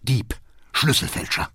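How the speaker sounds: hissed, voiceless accusation; furious whisper, word by word; accusing whisper. A narrator's reading. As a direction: whisper the insults through rage. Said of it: "flüstert der Vater".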